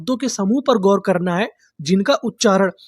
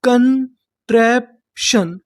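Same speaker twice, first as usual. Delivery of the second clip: slow sounding out; much slower than usual. Shown as a demonstration. Teacher to Hindi students learning to read English, slow syllable demo. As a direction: stretch कंट्रैप्शन out very slowly, syllable by syllable. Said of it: "तो meaning क्या बनी".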